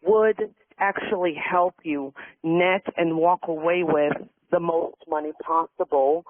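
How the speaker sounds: ordinary talk, nothing marked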